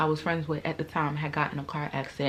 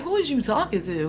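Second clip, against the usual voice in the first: confrontational challenge; 6 semitones higher, 4 dB louder than usual. Retold or imitated. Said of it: "once I hit you with the "who you talking to".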